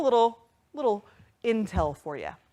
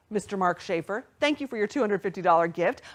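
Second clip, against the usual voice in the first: normal talking voice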